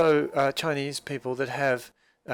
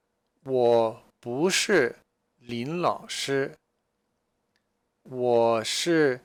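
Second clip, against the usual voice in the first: each syllable slow and clear; slow model reading; much slower than usual. Shown as a demonstration. Teacher reading Zhang Laoshi's lines slowly to students. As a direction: read it out slowly for the learners. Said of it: "Zhang Laoshi says".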